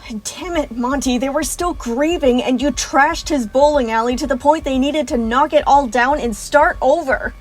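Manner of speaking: scolding Monty, rising to a furious shout; angry yelling. Acted. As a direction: tear into him, building to a shout. Villain yells at Monty.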